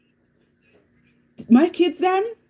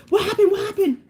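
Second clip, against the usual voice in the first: alarmed question; 11 semitones higher, faster than usual. Retold or imitated. Others rushing to the screaming.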